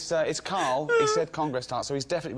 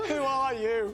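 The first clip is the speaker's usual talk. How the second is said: higher, laughing posh imitation; 12 semitones higher, much slower than usual. Host teasing Carl about his kidnap ordeal, laughing posh impression. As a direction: ham up a posh, breathless question through laughter. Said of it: "you do a posh voice".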